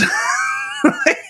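squealing burst of laughter; a high shrieking laugh; cracking up in a high shriek. Real, not acted. Dad laughing retelling the video.

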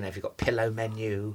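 usual speaking voice

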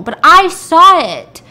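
loud, high, drawn-out protest; emphatic insistence. Retold or imitated. Witness insisting against doubting police, loud high protest. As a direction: stretch it out in loud indignation.

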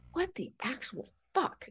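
hushed, stunned mock reaction; stunned disbelief. Reacting to friend's pregnancy news, hushed stunned disbelief. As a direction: barely voice it, in disbelief.

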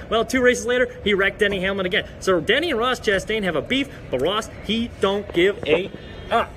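ordinary talk

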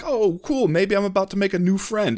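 high, hopeful and eager; hopeful excitement. Retold or imitated. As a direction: perk up like you found a friend. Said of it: "I'll be like".

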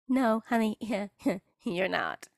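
usual speaking voice

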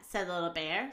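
plain reading voice

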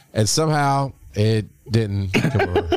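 ordinary talk, nothing marked

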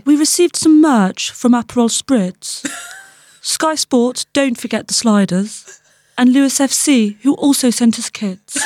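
slow, breathy royal impression; cartoon princess; Princess Diana voice. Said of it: "do your next couple of highlights as Princess Diana".

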